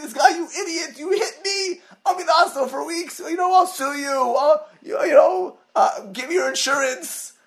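high-pitched imitating an irate victim; mock angry rant. Injured man yelling at the driver, mock outrage. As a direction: play the furious injured man, hammed-up. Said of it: "he's angry. He's not screaming".

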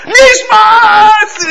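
high, loud ecstatic scream; hammed-up screaming worshipper; screaming prayer. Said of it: "He starts screaming like".

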